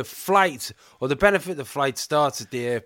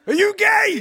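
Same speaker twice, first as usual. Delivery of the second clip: loud, much higher challenge; loud demand; 7 dB louder than usual. Pretend. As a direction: shout the question high. Imagined interrogation, Russian roulette scene.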